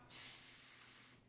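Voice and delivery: long soft hissed S like a snake. Shown as a demonstration. Teacher hisses the S like a snake for the child. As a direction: hiss the S like a serpent. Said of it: "comme le serpent".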